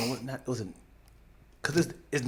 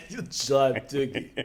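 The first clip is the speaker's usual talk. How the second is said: lower, softer mock Russian accent; 4 semitones lower, 6 dB quieter than usual. Echoing the slang as a Russian, low soft Russian accent. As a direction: laughingly attempt a Russian accent. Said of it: "need that in a Russian accent".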